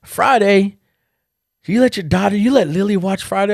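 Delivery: high, loud squeal of disbelief; mock outrage at the parenting; a hammed-up sister, high and loud with disbelief; shocked sister. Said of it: "She's like".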